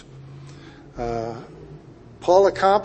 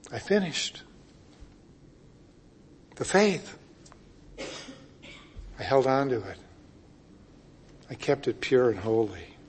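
soft, spaced-out phrases; hushed reflection; 4 semitones lower, 10 dB quieter than usual. Acted. Preacher as Paul thinking about his life, hushed. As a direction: say it quietly, pausing after each thought.

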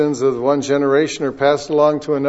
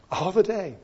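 much softer repeat of All the day; soft echo; 4 semitones higher, 9 dB quieter than usual. Shown as a demonstration. Preacher softly echoing All the day for the congregation. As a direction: let All the day fall away, hushed. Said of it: "notice how often they're doing it".

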